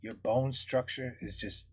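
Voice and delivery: half-joking tender flattery, very soft; mock tender compliment. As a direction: murmur it like a sweet nothing to the wife. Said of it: "I'm gonna be like".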